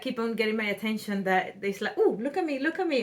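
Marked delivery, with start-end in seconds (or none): 2.0-3.0 showing off. Acted. Showing off the garment playfully.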